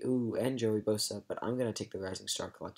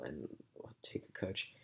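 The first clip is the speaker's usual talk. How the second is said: whispered aside; 5 semitones lower, 10 dB quieter than usual. Real, not acted. Quiet aside.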